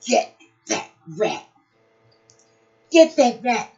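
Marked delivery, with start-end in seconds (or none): 2.9-3.7 loud command. Acted. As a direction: get that rat shouted out louder. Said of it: "said Pat".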